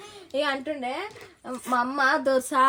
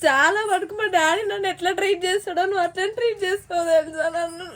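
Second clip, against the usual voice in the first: real crying, voice pitched up; tearful; 4 semitones higher than usual. Real, not acted. Tearfully telling mother-in-law nobody can treat her like dad, crying. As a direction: speak through tears, pushing the words out higher. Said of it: "నువ్వెందుకు ఏడుస్తున్నావు [name]".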